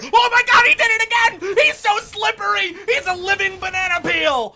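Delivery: screaming high hype call; excited scream. Real, not acted. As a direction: shriek it out in disbelief. Caster hype on an escape.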